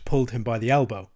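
ordinary delivery

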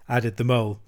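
usual speaking voice

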